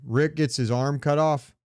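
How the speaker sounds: ordinary delivery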